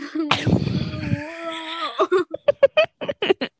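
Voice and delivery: big shrieking laugh; loud laughing outburst. Real, not acted. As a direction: burst out laughing hard. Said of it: "I drank water when you said that".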